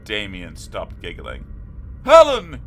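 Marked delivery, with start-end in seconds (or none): 2.0-2.6 very loud, high yell. A narrator's reading. Damien yelling for his sister, very loud. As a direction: yell her name in alarm. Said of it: "he yelled".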